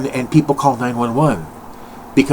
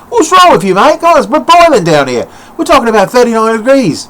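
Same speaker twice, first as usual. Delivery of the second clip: higher, louder Australian outburst, mate; Australian complaint; 10 semitones higher, 7 dB louder than usual. Retold or imitated. Australian viewers complaining about the heat, a loud Australian outburst. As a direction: bellow it like a sweltering Aussie. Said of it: "They're like".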